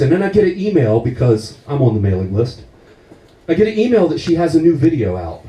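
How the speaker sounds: normal talking voice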